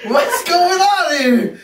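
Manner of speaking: high, drawn-out laughing astonishment; mock bewilderment, stretched out high through a laugh; baffled disbelief. Retold shock at the teacher's outburst.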